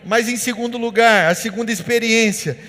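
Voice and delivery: loud, rising sermon declaration, words driven hard; full-force preaching. Acted. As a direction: preach it to the whole congregation, building to a roar.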